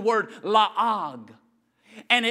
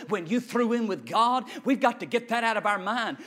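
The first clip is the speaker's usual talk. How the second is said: normal talking voice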